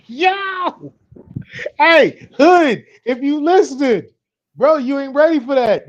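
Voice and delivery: high, loud, laughing shout; excited shout. Real, not acted. Husband shouting to his friend after hearing her Spanish, excited. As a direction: shout it out, blown away.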